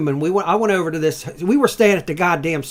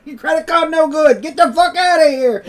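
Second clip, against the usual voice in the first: put-on much higher and louder accented scolding, cracking up; laughing mock broken-English lady; 13 semitones higher, 7 dB louder than usual. Motel lady chasing guest out, mock accent with laughter. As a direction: shoo him out in a put-on accent while laughing.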